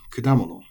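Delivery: kudamono with the pitch peak on da, falling after; accent on da. Shown as a demonstration. Teacher giving learners the correct kudamono. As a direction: rise to da in kudamono, then drop. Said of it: "right there on the da".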